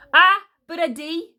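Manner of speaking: high, loud triumphant retort; glittering smile. A narrator's reading. Queen confirming her threat to Caliburn, gloating. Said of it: "with a glittering smile".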